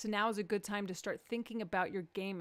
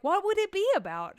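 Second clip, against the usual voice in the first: high, louder baffled question; clueless self-imitation; 10 semitones higher, 8 dB louder than usual. Comedian quoting herself to her wife, high and baffled. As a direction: ask it completely clueless. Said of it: "I'm like".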